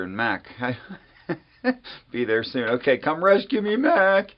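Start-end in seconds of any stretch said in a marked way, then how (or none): 3.0-4.3 high, louder playful plea; playful call for help. Real, not acted. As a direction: call out to Mac to come save you. Calling to a late guest.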